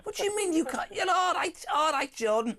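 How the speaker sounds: high-pitched, hammed-up Scouse protest; mock Scouse accent. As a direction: put on a high Scouse voice, teasing the caller. Joking Scouse protest after caller declines.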